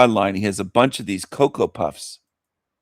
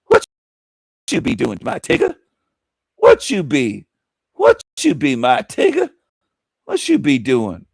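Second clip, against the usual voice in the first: mocking taunt; 3 semitones higher than usual. Mocking the crying man.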